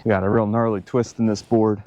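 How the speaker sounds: ordinary talk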